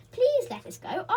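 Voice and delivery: loud, high pleading; crying out. A narrator's reading. Beth pleading with Joe, crying out. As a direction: beg out loud. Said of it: "cried Beth".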